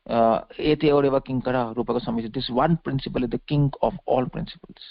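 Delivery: normal talking voice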